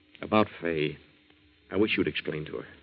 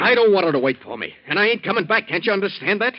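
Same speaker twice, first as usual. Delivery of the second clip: heated outburst; 10 semitones higher, 6 dB louder than usual. Acted. Danny refuses to return to Fay.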